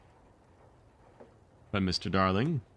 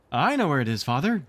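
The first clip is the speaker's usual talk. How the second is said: cried out; 6 semitones higher than usual. Acted. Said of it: "Wendy cried".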